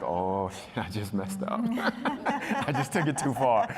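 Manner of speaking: rueful words breaking into laughter; laughing realization. Retold or imitated. Kid realizing he crossed his uncle, laughing it off. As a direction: laugh through the admission, pitch climbing. Acts out realizing he went too far.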